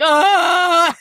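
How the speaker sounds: loud hammed-up wail, face crumpling; mock ugly-cry wail. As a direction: burst into a comic sob.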